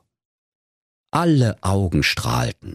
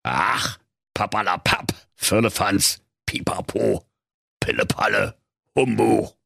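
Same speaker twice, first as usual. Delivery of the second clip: lightly muttered grumpy dismissal; grumbling dismissal. A narrator's reading. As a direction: brush it all away with a grumble. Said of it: "brummte er nur".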